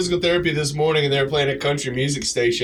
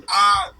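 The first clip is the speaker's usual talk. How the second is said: high, loud pretend yelp; mock yelp of pain; 3 dB louder than usual. Imagining tearing an Achilles, high pretend yelp. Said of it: "Can you imagine doing the Gritty".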